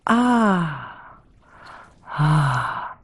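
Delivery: long, falling sighs of release; relieved sigh. Acted. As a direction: let go with a drawn-out ah. Demonstrates relaxing as third possibility. (lange, entspannte Seufzer)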